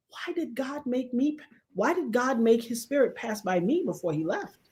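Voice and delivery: hushed awe. Retold or imitated. Awed questioning.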